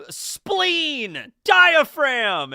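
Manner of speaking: high loud shouting; silly shout. Retold or imitated. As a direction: yell the words like a game. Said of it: "you just say".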